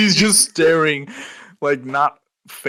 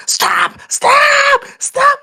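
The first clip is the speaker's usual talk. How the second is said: high, loud panicked shriek; shrieking stop; 9 dB louder, much slower than usual. Retold or imitated. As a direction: scream it at the top of your voice. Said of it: "she's like".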